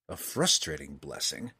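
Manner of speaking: low, understated offhand remark; casual reply. A narrator's reading. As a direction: toss it off low and lightly. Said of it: "Driz replied casually".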